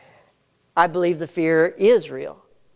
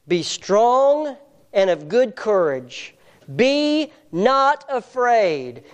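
higher, slow and stately, stretching each phrase; solemn scripture reading; 7 semitones higher, slower than usual. Acted. Preacher reading God's command to Joshua, slow and solemn. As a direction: proclaim the verse slowly from the pulpit.

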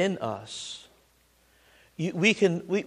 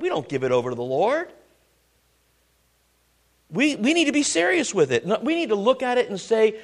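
normal talking voice

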